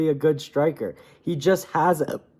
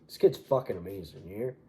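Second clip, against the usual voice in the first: a badly faked promoter gushing; 8 semitones lower, 10 dB quieter than usual.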